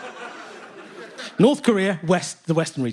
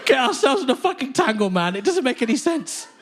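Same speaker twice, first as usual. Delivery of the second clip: exasperated rant; 4 semitones higher than usual. Acted. Mock outrage at the whole tangle.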